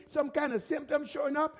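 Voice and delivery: usual speaking voice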